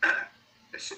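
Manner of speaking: usual speaking voice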